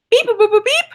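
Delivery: high beep boop dialing sounds; phone beeps. Retold or imitated. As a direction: beep like a keypad. Mimicking phone dialing noises.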